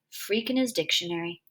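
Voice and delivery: usual speaking voice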